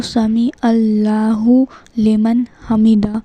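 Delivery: slow, word-by-word recitation with long vowels; unhurried recitation. Shown as a demonstration. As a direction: model it slowly and carefully for learners. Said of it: "آرام سے پڑھنا ہے".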